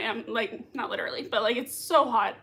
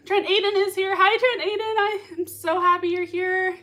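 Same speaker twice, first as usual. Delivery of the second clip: high, raised happy welcome; gushing, pitched up, slowing at the end; excited greeting; 7 semitones higher, 4 dB louder than usual. Real, not acted. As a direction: announce a friend's arrival with delight. Greets arriving viewer.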